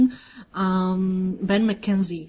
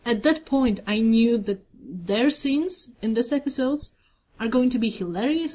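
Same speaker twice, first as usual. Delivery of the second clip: ordinary talk, nothing marked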